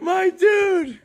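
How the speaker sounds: high, loud laughing shout. Real, not acted. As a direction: holler it in disbelief. Cracking up at friend's confession.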